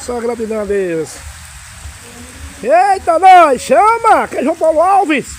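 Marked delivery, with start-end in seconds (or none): 2.6-5.3 excited shout. Real, not acted. Vlogger shouting his name to viewers on a rainy Sunday, excited. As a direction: hype it up, shouting to the fans.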